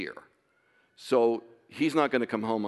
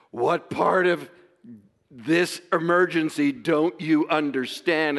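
louder, higher, slow exasperated outburst; exasperated demand; 4 semitones higher, 5 dB louder, slower than usual. Retold or imitated. Father retelling how he pressed the police, louder and exasperated. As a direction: make them hear the emergency, slowed and pressing. Retold plea to casual police.

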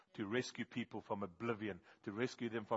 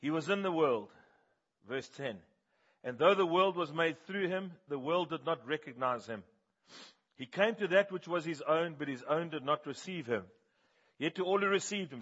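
loud, raised scripture reading to the congregation; preaching scripture; 5 semitones higher, 6 dB louder than usual. Acted. Preacher reading John chapter one to the church, loud and raised. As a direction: proclaim the verses from John high and loud.

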